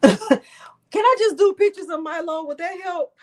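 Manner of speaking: high, loud, half-laughing through real tears; teary laughing plea. Real, not acted. Teary after crying on stream.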